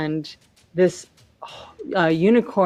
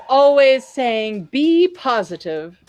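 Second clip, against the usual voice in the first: higher mocking jab, slowing for the punchline; insulting retort; 5 semitones higher, 4 dB louder than usual. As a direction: land the final insult with glee. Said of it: "And Moran goes".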